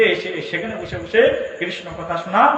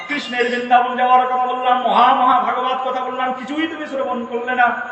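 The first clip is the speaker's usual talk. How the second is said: lamenting complaint; 6 semitones higher, 4 dB louder than usual. Acted. Shiva lamenting Parvati missed all.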